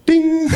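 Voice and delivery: sharp ding sound; message ding. Retold or imitated. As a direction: mimic a phone chime. Phone notification sound.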